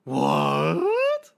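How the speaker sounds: drawn-out incredulous cry; shocked disbelief. Real, not acted. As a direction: cry out, stretching the word in disbelief. Listener's shock that they left.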